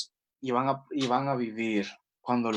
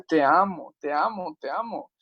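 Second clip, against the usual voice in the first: fading tender repetition; 4 semitones higher than usual. Pretend. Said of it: "Te dice".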